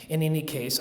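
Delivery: ordinary delivery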